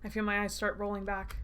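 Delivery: ordinary delivery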